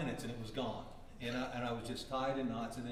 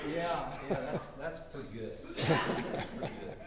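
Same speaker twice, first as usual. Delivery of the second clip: wry, laughing "yeah, that's pretty good"; laughing mock approval; 3 semitones higher, 6 dB louder than usual. Teacher imitates his own reaction to Dale's song, laughing in mock approval. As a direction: pretend to approve while laughing.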